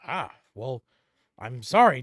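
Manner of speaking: usual speaking voice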